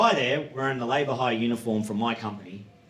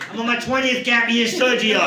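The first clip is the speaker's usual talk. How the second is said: high, loud mock rant; indignant raised-voice punchline; exasperated outburst; 9 semitones higher, 5 dB louder than usual. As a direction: yell it in exasperation at a young co-worker. Climax of rant at backpacker.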